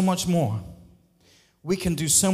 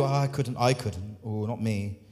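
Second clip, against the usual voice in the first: lower, softer, bashful refusal; mock timid refusal; 7 semitones lower, 4 dB quieter than usual. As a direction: shrink away from the call, timidly. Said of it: "this is what we do".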